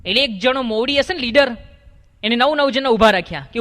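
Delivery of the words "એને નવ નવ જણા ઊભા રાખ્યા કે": usual speaking voice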